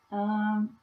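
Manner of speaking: ordinary delivery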